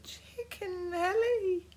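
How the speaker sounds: high slow small voice; girl caught out; timid. A narrator's reading. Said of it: "said Katie Morag timidly".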